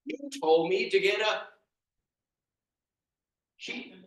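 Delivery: loud, high, furious rant with animal noises; angry rant. Acted. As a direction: storm at the wise man. Peter rants at the wise man.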